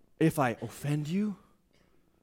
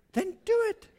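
mock permission. Mocking worldly counsel.

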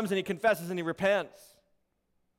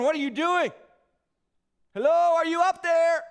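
high, loud mock call up to God; acted bewilderment, raised and louder; confused calling out; 7 semitones higher, 4 dB louder than usual. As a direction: call up to someone who isn't answering. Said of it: "Confused, wondering".

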